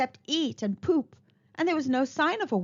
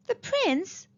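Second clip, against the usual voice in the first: surprised cry; 10 semitones higher than usual. A narrator's reading. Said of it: "cried Tom".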